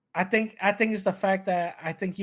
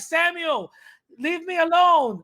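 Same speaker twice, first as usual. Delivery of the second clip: higher, exasperated ex, fast at the end; annoyed ex-girlfriend; 8 semitones higher, 3 dB louder than usual. Pretend. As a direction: brush him off, already done with him. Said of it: "they're gonna be like".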